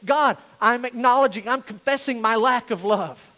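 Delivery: loud, emphatic declaration; earnest confession. Shown as a demonstration. Preacher showing the room how to confess, earnest. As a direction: confess it with force. Models confession to God forcefully.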